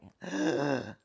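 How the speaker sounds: a sudden sharp intake of breath; sharp gasp. Retold or imitated. Reliving her release on the bed, a sharp gasp. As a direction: gasp as if struck by it.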